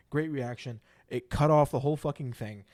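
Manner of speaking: really angry rant about the lost clip; mad. Real, not acted. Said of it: "I'm so mad".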